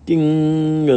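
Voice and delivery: high, loud, ringing singsong; ice cream truck chime. A narrator's reading. Ice cream truck driving through, loud high chime. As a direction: chime like a bell. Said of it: "The ice cream truck is saying".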